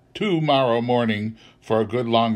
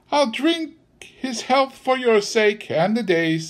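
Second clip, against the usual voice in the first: high-pitched grudging wife; Mrs Cratchit; 12 semitones higher than usual. A narrator's reading. Wife agrees to drink for Bob's sake, grudging. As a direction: concede it on a high, reluctant note. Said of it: "said Missus Cratchit".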